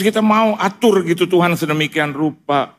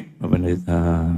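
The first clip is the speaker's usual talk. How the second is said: much lower, softer and slow "Pak Pendeta"; friend's weak troubled call; 10 semitones lower, 4 dB quieter, slower than usual. Retold or imitated. Friend phoning the pastor in trouble, low and faint. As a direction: drag the words out like someone ashamed. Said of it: "Tapi ini".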